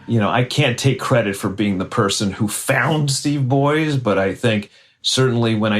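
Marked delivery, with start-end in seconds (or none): none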